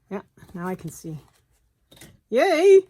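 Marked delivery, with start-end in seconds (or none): 2.3-2.8 high, loud happy yay; excited cheer. Real, not acted. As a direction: cheer out in sudden delight. Finally sees the hole.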